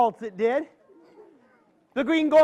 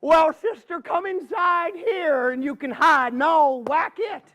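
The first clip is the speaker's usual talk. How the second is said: groundhog offering help; 6 semitones higher than usual. Acted. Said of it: "He said".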